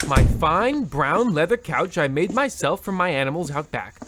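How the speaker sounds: ordinary talk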